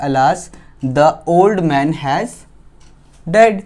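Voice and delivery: very slow, spaced-out reading; slow dictation. Real, not acted. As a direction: read the example out slowly for learners. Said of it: "मैं slow slow बोलूँगा". (बहुत धीरे-धीरे पढ़कर लिखवाना)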